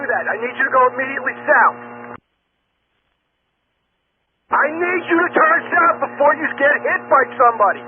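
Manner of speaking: insist, voice rising with alarm; urgent. Real, not acted. Controller urging pilot to turn.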